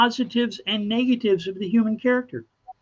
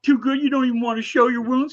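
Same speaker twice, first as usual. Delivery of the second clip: higher, louder taunting crowd; mocking sneer; 6 semitones higher, 4 dB louder than usual. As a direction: jeer at him for being too proud. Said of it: "They go".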